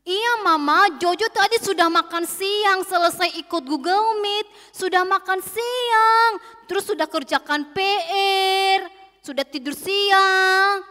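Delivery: lightly voiced child stretching out each answer; Jojo fibbing to mama. A narrator's reading. Jojo lies to mama about lunch and homework, sing-song child voice.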